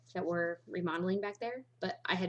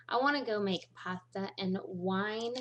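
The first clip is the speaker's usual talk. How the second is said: normal talking voice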